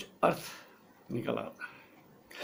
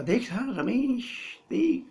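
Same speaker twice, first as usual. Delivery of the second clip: 1 teasing teacher; 9 semitones higher, much slower than usual. Acted. Teacher mock-scolding the boy.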